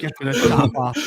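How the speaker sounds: mock Austrian accent at talking volume; Arnold Schwarzenegger. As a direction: toss off a hammed-up Austrian line. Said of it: "Was it Arnold Schwarzenegger?".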